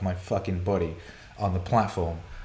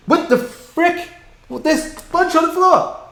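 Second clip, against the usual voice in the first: alarmed disbelief; 10 dB louder than usual. Real, not acted. Streamer finding bugs all over his floor, in high disbelief. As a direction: freak out at the swarm.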